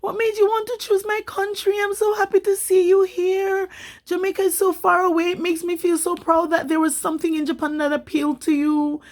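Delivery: high, delighted, fawning welcome; gushing Japanese locals. Retold or imitated. Kind locals greeting the Jamaican newcomer, high and gushing. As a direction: gush over a foreigner like a thrilled host. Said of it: "they're like".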